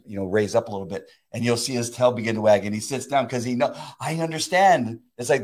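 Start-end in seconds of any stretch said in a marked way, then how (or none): 4.0-5.0 high, stretched-out moment of realization; slow, dawning excitement; dog suddenly understanding. Acted. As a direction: voice the dog finally getting it. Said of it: "the dog gets excited".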